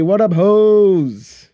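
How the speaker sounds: loud, slow shout-out. Real, not acted. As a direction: call out the greeting, stretched. Shout-out to friend.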